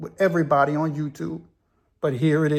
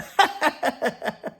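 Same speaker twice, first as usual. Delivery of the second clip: high burst of laughter; Al's laugh; 8 semitones higher than usual. Retold or imitated. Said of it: "And Al laughs".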